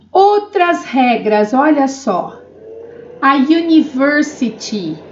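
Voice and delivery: ordinary talk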